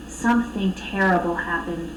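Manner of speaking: ordinary delivery, nothing marked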